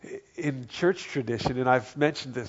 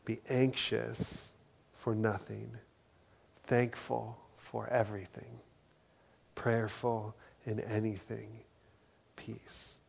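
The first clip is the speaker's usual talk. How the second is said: softer and slower, last word peace breathed out in a whisper; softer repeat; 6 dB quieter than usual. Shown as a demonstration. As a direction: let it sink in gently, whisper peace. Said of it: "Let me say that again".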